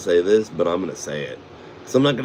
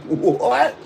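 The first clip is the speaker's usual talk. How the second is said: much higher stunned squeak; shocked what; 14 semitones higher than usual. Retold or imitated. Hearing her brother is in Green Day, a much higher shocked squeak. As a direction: squeak out your disbelief. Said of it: "I'm like".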